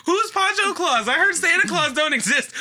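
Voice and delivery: high and laughing through it; laughing child question. Retold or imitated. Retelling asking grandpa about Pancho Claus, high and laughing. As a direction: giggle out the confused question. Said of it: "I was like".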